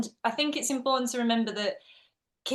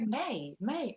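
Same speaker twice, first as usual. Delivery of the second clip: puzzled echo; 7 dB quieter than usual. Retold or imitated. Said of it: "And I go".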